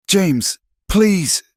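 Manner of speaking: high, pleading cry of his name; weeping plea. A narrator's reading. Wife begging James as he leaves, in tearful pleading. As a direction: beg him through tears, pitched high. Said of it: "she wept".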